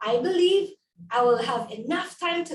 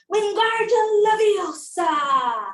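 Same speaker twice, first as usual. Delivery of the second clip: witch spell; 5 semitones higher, 5 dB louder than usual. Acted.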